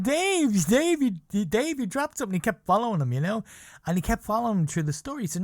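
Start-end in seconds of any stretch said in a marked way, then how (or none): 0.0-2.3 calling out. Retold or imitated. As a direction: shout after him to get his attention. Friend chasing celebrity calling.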